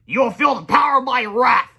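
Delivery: slow, loud, high threat; menacing shout. A narrator's reading. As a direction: threaten with booming wrath. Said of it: "said Big Melvin".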